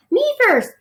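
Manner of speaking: high, loud squealing mice; shrill little mice. A narrator's reading. Little mice fighting for a ride, shrill squeal. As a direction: squeal it like tiny eager mice. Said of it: "shrilled the little mice".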